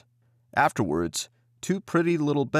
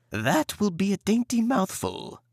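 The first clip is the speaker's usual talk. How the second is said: higher, greedy witch voice; witch relishing; 9 semitones higher than usual. Acted. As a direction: savour the thought of a meal. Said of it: "she muttered to herself".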